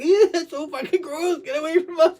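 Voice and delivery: high-pitched mock disgust; grossed-out friends. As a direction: squeal it like friends recoiling from snot. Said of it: "your friends are like".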